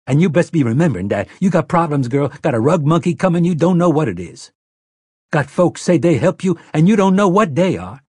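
bully her with crude scorn; harsh, crude Detta. Acted. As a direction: Detta Walker laying down the law to Mia. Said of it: "harsh and crude and brooked no argument".

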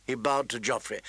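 plain reading voice

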